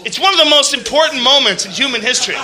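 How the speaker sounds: loud, laughing overstatement; mock grand proclamation. Hyping the vomit video to the audience, laughing and loud. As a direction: hype it up with a laugh.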